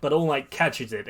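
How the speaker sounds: plain reading voice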